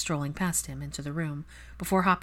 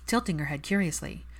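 ordinary delivery, nothing marked

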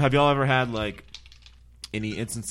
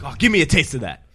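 1 demanding customer; 7 semitones higher, 9 dB louder than usual. Retold or imitated. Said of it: "And the guy's like".

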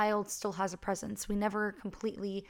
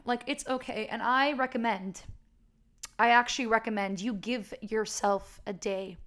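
ordinary delivery

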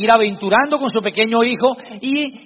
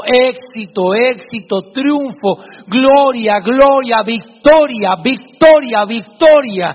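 louder, ringing echo that repeats each word; triumphant echo; 2 semitones higher, 7 dB louder, much slower than usual. Acted. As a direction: boom the words back like a cave echo. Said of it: "escuchaba en el fondo".

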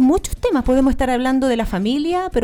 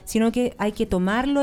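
ordinary delivery

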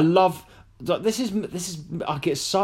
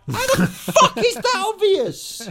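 incredulous. Retold or imitated. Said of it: "I'm like".

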